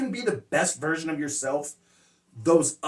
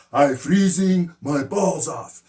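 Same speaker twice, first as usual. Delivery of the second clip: louder, slow imitation of an English learner's accent; Amigo's learner accent; 6 dB louder, slower than usual. Student practices new slang phrase, in learner's accent. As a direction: mimic a careful, foreign-accented learner, drawn out. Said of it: "So he practices".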